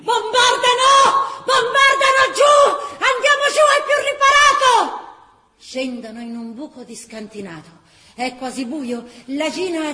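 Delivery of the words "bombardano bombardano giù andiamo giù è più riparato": frantic yell to take cover; panicked shout. Acted. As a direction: cry out as the bombs fall. Panic at bombardment.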